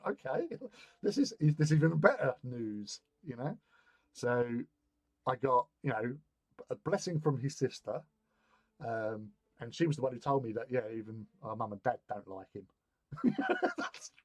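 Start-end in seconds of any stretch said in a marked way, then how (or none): 0.1-3.0 pleased surprise. Retold or imitated. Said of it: "And I went". 13.1-13.7 high laugh. Real, not acted. Laughing at the story.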